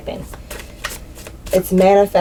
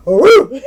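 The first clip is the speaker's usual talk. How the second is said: mock dog bark; 7 semitones higher, 6 dB louder than usual. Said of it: "bark like a dog".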